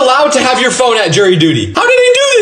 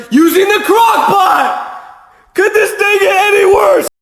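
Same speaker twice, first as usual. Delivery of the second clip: mock despairing wail; 12 semitones higher than usual. Crock pot dinner dismay.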